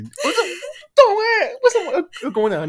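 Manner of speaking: high, loud, whining cry of disbelief; whiny disbelief. Real, not acted. Telling friends about the show-off player, whining high and loud. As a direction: wail it like you can't believe it.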